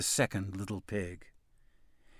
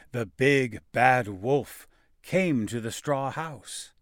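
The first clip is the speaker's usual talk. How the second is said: usual speaking voice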